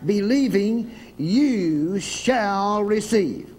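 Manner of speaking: slow solemn scripture reading. Acted. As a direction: read it out like holy writ. Preacher reads scripture slowly.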